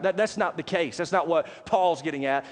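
usual speaking voice

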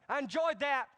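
high, loud mock approval of the song; mock delight. Preacher mimicking worshippers judging songs, in mock delight. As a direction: parody a picky churchgoer. Mocking worshippers' picky thoughts.

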